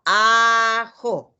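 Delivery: "Ajo" said loud and clear; model word said aloud. Shown as a demonstration. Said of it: "Hacemos lo mismo". ("Ajo" dicho fuerte)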